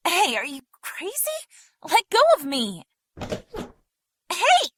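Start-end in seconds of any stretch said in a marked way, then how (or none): 4.3-4.7 sharp high yell of protest; startled shout. Acted. Susie yelling as she is dragged off, high shout. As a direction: cry out as a stranger grabs you.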